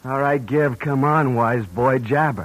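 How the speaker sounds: loud, barked orders at the captive; threatening demand. Acted. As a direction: push him hard to talk. Gunman demands talk, threatening.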